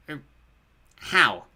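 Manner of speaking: timid underling, halting before the question; hesitant toad. A narrator's reading. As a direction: venture it warily to your master. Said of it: "the toady asked".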